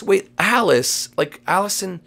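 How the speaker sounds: ordinary talk, nothing marked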